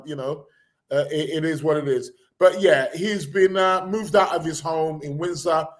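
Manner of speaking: ordinary talk, nothing marked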